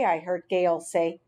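plain reading voice

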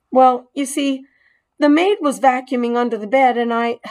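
fumbling for an excuse; lightly voiced mouse. Mouse making excuses to the boy, hurried.

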